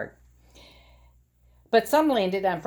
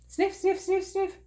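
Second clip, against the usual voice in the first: high sniffs; 13 semitones higher than usual. Acted. Frogalina sniffs the air.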